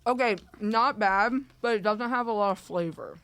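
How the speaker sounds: talking while chewing donut; mouth full. Real, not acted. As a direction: speak through a mouthful. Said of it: "a mouthful of donut".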